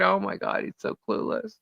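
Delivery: hushed disbelieving whisper; whispered aside. Retold or imitated. Watching his video, whispering to herself. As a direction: breathe it out under your breath. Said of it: "And I'm thinking".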